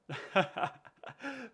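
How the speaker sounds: a quick high laugh. Real, not acted. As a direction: laugh at your own joke. Laughs at the joking name.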